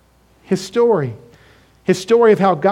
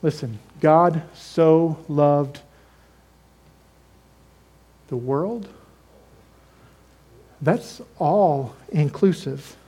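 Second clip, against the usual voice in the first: soft earnest emphasis; 4 semitones lower, 6 dB quieter, slower than usual. Real, not acted. Softens to make the point.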